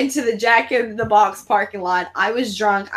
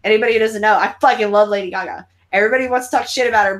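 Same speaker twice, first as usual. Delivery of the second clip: normal talking voice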